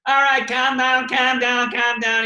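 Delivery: hammed-up Bill telling the crowd to settle, slow; imitating Bill. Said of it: "He goes".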